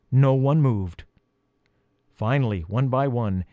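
plain reading voice

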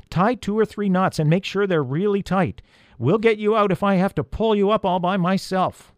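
high, bold rallying voice; confident shout. A narrator's reading. Peter promises the elf rescue, bold shout. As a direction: call down with determination. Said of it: "He sounded much more confident".

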